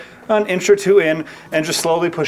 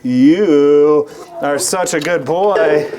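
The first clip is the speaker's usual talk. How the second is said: slow praising pet; 4 dB louder, much slower than usual. Real, not acted. Owner praising goat after shot.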